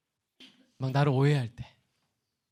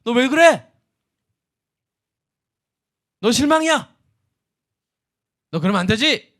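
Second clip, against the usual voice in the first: higher, louder mock reproach; 7 semitones higher, 8 dB louder than usual. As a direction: nag a struggling friend, pretend. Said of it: "몰라서". (힘든 사람을 다그치는 흉내)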